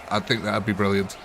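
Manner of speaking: ordinary delivery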